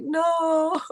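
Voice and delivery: high mock-protesting no; indignant no. Streamer retelling denying her sister's teasing, high mock protest. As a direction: deny it playfully to your sister. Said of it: "I'm like".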